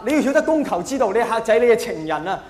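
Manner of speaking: usual speaking voice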